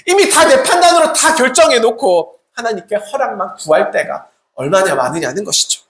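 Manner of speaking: normal talking voice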